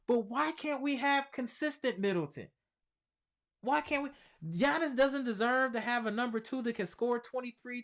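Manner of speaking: exasperated rant. Real, not acted. Exasperated rant about Middleton.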